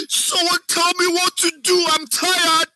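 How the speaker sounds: very high desperate wail; crying. Shown as a demonstration. Acting teacher demonstrates an exhausted victim begging for help, crying. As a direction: cry out helplessly at the top of your range. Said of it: "and I'm crying".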